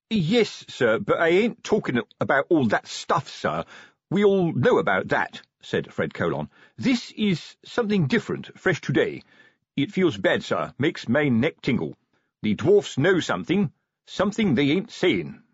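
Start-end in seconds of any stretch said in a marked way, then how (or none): 0.1-5.4 higher-pitched, earnest copper; stolid sergeant, protesting. A narrator's reading. Sergeant Colon's character voice. 6.8-15.4 higher-pitched, earnest copper; stolid sergeant, uneasy. A narrator's reading. Sergeant Colon's character voice.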